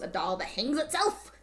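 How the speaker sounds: incredulous question, sharp rise on the end; mock-baffled retelling, rising question; incredulous retort. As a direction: throw it back in disbelief, voice climbing at "itself". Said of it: "Just like that".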